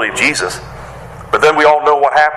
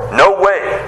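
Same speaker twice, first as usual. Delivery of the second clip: high-pitched acted objection; refusal; 8 semitones higher than usual. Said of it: "we see Peter saying no".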